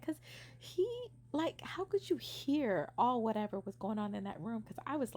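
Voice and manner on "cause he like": normal talking voice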